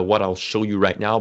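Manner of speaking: ordinary delivery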